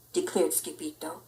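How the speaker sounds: plain reading voice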